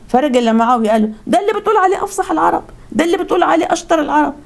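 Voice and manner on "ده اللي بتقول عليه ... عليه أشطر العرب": high-pitched, fast mocking complaint; scornful boasting. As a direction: scoff as if reporting a rival's failure. Man scoffs to Muawiya about Ali. (تهكم واستخفاف بنبرة عالية)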